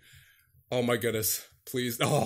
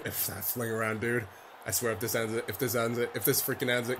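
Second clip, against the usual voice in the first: low, tense dread; 4 semitones lower, 8 dB quieter than usual. Real, not acted. Dreads a losing goal.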